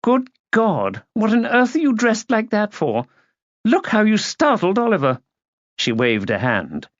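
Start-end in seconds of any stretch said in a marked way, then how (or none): 0.0-5.2 high-pitched indignant disapproval; appalled haughty lady. A narrator's reading. Aunt scolding niece about her dress, haughty and appalled.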